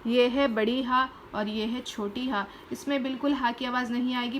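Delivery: ordinary talk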